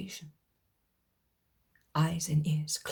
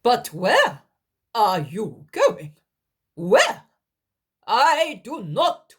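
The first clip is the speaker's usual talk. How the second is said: loud, high urgent outcry; alarmed demand; 6 semitones higher, 9 dB louder, slower than usual. Acted. Helkai stopping Daniel from leaving, high loud outcry. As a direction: try to stop him leaving.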